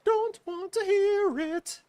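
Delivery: very high, slow sing-song. Pretend. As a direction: lilt it up high and slow, teasing. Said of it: "that was done in a sing-song voice".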